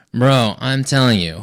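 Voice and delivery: usual speaking voice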